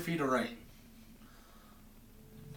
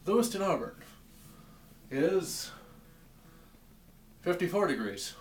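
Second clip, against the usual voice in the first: mock weatherman; 6 semitones higher, 3 dB louder than usual. Mocking a TV weatherman.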